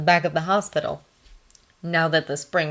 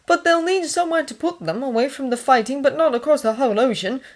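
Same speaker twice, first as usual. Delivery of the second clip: lightly voiced higher-pitched character; Thomas in conversation; 8 semitones higher than usual. A narrator's reading. Thomas talking hospital plans with the nurse, conversational. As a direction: lift the voice for his dialogue.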